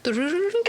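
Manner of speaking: very high tinkling fairy chime; high fairy sparkle sound. Retold or imitated. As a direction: sing it high and light like a twinkling fairy. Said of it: "Tinkerbell came and just went".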